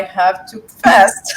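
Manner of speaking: breaking into a loud laugh on the last word; laughing exclaim. Real, not acted. Telling the listener about Ramadan, loud laughing exclaim. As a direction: cry it out as the laugh bursts through.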